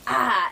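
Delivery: short loud "ah"; demonstrated yell. Shown as a demonstration. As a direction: show the yell in one burst. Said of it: "me yelling at Joy Ryan is".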